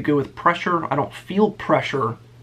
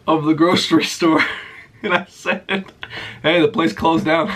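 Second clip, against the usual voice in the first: laughing. Retold or imitated. Laughing while retelling the prank.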